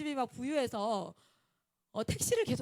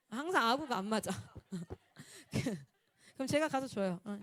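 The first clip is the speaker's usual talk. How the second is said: ordinary talk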